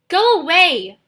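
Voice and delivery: high, very loud yell. Acted. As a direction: drive him off with a yell. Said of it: "he yelled".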